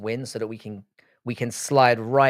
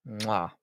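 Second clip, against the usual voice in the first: smacked "mwah" of approval; chef's kiss; 6 dB quieter than usual. Real, not acted. Fight fan savouring the promised beef, chef's kiss. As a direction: kiss the air in relish. Kiss sound finishing his praise.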